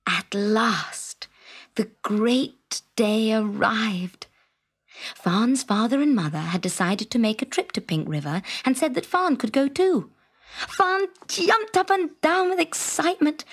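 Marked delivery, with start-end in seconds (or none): none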